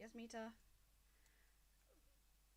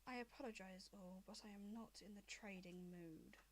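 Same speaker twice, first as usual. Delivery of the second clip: lightly voiced dragon mother; 3 semitones lower, 5 dB quieter, slower than usual.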